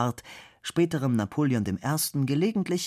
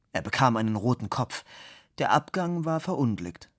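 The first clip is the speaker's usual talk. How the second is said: ordinary delivery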